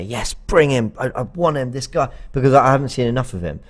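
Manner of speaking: usual speaking voice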